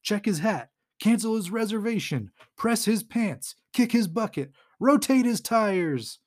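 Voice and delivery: high mock battle cries, one after another; playful rallying list, stretching some phrases; retold yelling. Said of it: "they're all yelling".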